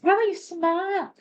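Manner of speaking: high, mocking imitation of a man telling her to smile; mocking catcaller. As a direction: sneer it like a pushy stranger. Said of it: "guys tell you to smile all the time".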